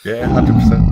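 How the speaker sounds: loud, eager agreement; excited. Real, not acted. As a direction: burst out in full agreement.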